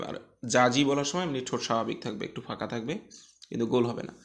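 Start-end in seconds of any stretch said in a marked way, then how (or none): none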